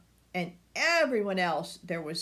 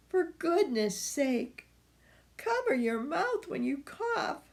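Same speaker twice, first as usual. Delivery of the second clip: higher, fussy motherly voice; fussing grandma; 7 semitones higher than usual. Acted. Grandma telling Bert to rest, fussing higher. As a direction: fuss over him like a grandma.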